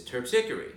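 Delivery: Terpsichore said as terp-SICK-er-ee; correct Greek pronunciation. Retold or imitated. Guide quoting the mailman's answer, Terpsichore as terp-SICK-er-ee. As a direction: punch "SICK" in Terpsichore, four syllables. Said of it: "which is the correct way".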